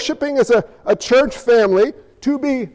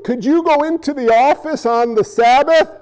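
ordinary talk, nothing marked

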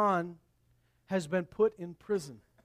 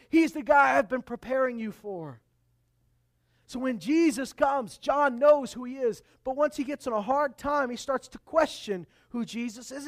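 loud, ringing proclamation held through the lines; full-force preaching; 7 semitones higher, 10 dB louder than usual. Acted. Preacher booming John's words to the congregation. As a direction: preach it out to the whole room.

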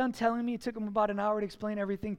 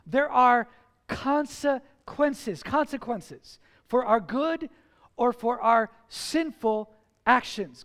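emphatic preaching, slow and punched; 5 dB louder, slower than usual. Acted. Pastor preaching consequences to the church, emphatic. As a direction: drive each word home to the congregation.

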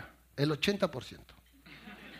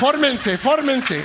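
loud, higher joking command; mock order; 7 semitones higher, 9 dB louder, much slower than usual. Real, not acted. Comedian ordering the laughing crowd to line up, loud joking call. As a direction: order them to line up, playfully. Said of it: "joking to laughing audience".

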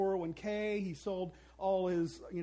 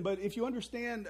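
ordinary talk, nothing marked

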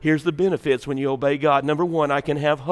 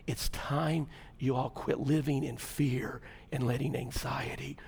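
hushed earnest preaching; 2 semitones lower, 9 dB quieter than usual. Real, not acted. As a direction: drop to a hush and urge the congregation. Turns to hushed earnest exhortation.

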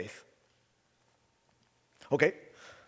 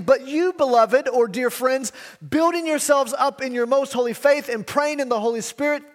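higher and louder, proclaiming the verse; proclaimed scripture; 7 semitones higher, 4 dB louder than usual. Acted. Preacher proclaiming Jude's command to the church, fervent.

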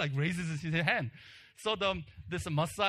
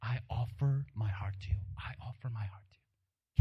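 soft, devout whisper; a young king whispering his offering to God; whispered prayer; 5 semitones lower, 3 dB quieter than usual. Pretend. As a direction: breathe it like a private prayer. Solomon prays while sacrificing.

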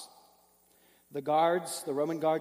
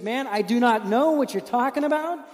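loud, higher exasperated denial; 6 semitones higher, 5 dB louder than usual. Acted. Peter's third denial to the bystander, loud and exasperated. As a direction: brush him off, fed up.

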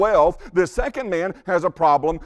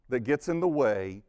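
normal talking voice

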